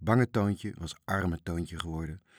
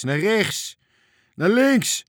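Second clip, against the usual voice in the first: loud, high yells, climbing higher; shouted directions; 12 semitones higher, 9 dB louder, much slower than usual. A narrator's reading. Frits shouts conflicting directions to Toontje, yelling. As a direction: yell each direction urgently, the second higher. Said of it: "schreeuwde Frits".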